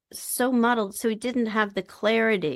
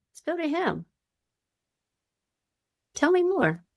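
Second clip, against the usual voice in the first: gentle inviting therapist; 10 semitones higher than usual. Acted. Therapist inviting the Grandpa part to talk, lightly high and gentle. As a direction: coax the part to open up.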